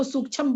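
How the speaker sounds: full chha in sukshma, final vowel lost; wrong sukshma as "sukchham". Shown as a demonstration. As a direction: mispronounce sukshma as "suk-chham". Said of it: "तो वो गलत बोलते हैं".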